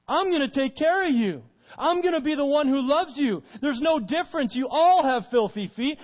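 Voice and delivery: loud, high, insistent sermon voice; preacher voicing Jesus to the church, full force; full-force preaching. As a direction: drive it home like a fiery preacher.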